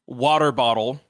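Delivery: "water bottle" said the American way, slowly; plain American "water bottle", consonants sounded; American pronunciation. Shown as a demonstration. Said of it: "I'm pronouncing it like an American".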